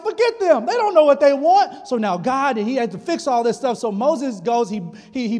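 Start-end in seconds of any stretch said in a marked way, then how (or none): 0.0-1.9 high, loud angry outburst; angry shout. Retold or imitated. Moses angrily telling Aaron the people don't matter. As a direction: snap back in full anger.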